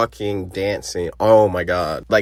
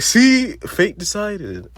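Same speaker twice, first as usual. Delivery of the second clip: gloating Marcus; 12 semitones higher, slower than usual. Retold or imitated. Marcus gloating after Mike leaves.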